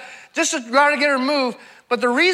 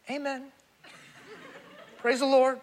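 very soft, lifeless mock amen; subdued churchgoer; 8 dB quieter than usual. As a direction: imitate a congregation barely responding. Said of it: "probably on Sunday, are like".

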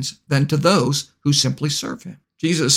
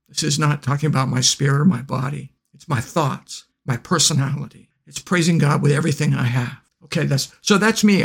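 usual speaking voice

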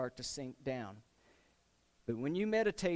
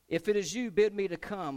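higher and louder plea; bold eager call; 5 semitones higher, 7 dB louder than usual. Acted. Peter begging Jesus to call him onto the water, loud. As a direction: shout the request across the waves. Peter's request, acted boldly.

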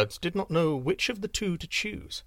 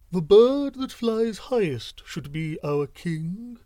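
higher, slower owl voice, a touch grand; wise owl; 5 semitones higher, 5 dB louder, slower than usual. A narrator's reading. Owl proposing a contest to the birds, slow and knowing. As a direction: pronounce it like a wise old owl. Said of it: "said the owl with a wiser look".

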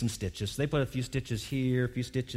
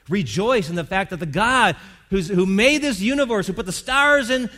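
rising louder and higher, slowing into awe; preacher lifting to a climax about God; building preaching; 6 semitones higher, 6 dB louder than usual. Acted. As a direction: swell into a preaching crescendo.

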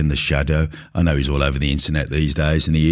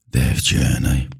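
lower, slow, dripping with scorn; sneering mock quote; 4 semitones lower, much slower than usual. Shown as a demonstration. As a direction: drawl it out mockingly, lower. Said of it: "I hate this word".

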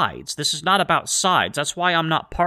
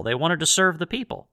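ordinary delivery

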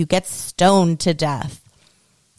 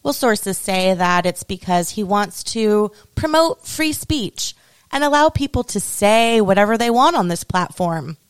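ordinary talk

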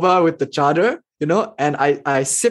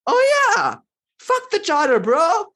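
much higher, breezy dismissive exclamation; snapping out of it. Retold or imitated. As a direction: toss the chadar away with sudden cheer. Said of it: "I immediately snapped out of it".

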